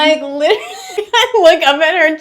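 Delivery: high, loud words through giggles; laughing. Real, not acted. Artist teasing partner for not looking, laughing. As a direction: tell it while cracking up.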